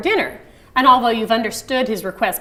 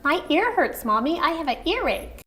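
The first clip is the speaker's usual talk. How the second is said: put-on a touch of a whiny small child, higher; lightly voiced child; 7 semitones higher than usual. Shown as a demonstration. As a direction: complain like a little kid to mommy.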